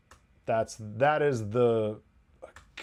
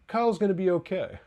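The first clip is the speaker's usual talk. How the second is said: raised, breezy reassurance; mock reassuring; 7 semitones higher than usual. Podcaster mimicking a character's casual reassurance. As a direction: toss it off like it's no big deal. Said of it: "He's like".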